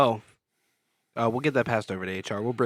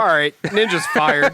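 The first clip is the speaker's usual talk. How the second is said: mock boss firing; 4 semitones higher, 12 dB louder, slower than usual.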